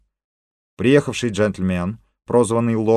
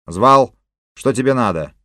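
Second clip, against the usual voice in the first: loud gruff gang boss; rude loud king; 4 semitones higher, 7 dB louder than usual. Acted. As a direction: bark it like a boss. Gang king bursts in rudely.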